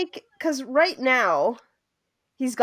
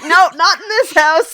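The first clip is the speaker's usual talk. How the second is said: louder, higher mom voice; mock stern refusal; 6 semitones higher, 9 dB louder than usual. Mom refusing what she found, mock stern. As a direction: put on an indignant mom laying down the law.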